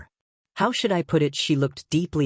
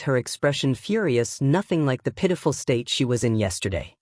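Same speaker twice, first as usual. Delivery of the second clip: ordinary delivery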